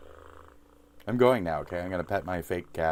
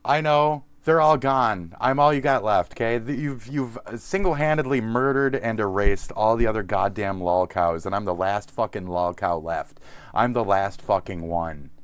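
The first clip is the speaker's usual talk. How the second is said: loud mock rant; 3 semitones higher, 9 dB louder than usual. Mock outraged rant at viewers.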